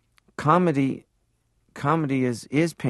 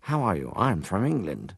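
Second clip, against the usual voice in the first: mock upper-class English accent, swooping intonation; exaggerated posh English actor's accent; 4 dB quieter than usual. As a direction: parody an old-school English film actor. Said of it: "Most actors were like".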